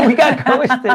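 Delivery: laughing in disbelief. Real, not acted. Host laughing about the author ghosting them, amused. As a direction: chuckle through the words.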